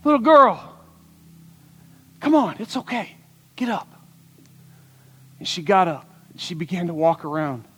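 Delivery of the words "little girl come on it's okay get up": high, then softening to a hush; gentle Jesus. Acted. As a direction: coax her up tenderly. Jesus coaxes the girl gently.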